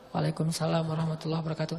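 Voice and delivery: softly and quickly answer, barely audible; very soft, fast return greeting; hushed quick reply. Retold or imitated. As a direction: mumble the full reply so it won't be heard. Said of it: "Karena saya pelankan suara".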